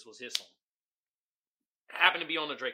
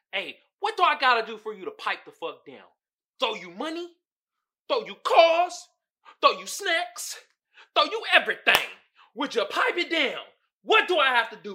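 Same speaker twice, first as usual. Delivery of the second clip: pleading lover; 5 semitones higher, 8 dB louder than usual. Retold or imitated. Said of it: "she telling, telling the female".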